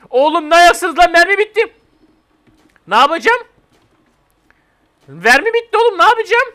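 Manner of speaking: high frantic shouts about empty ammo; panicked yelling. Real, not acted. Yelling at teammates as ammo runs out, frantic and loud. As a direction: yell in real panic, pitch climbing.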